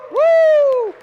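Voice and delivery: high whooping cheer; excited woo. Real, not acted. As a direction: whoop for the crowd. Cheering the teams.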